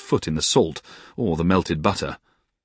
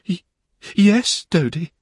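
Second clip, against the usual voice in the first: put-on much higher, stammering young wife; shy Dora; 12 semitones higher, slower than usual. A narrator's reading. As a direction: falter then brighten. Dora's shy stammered reply.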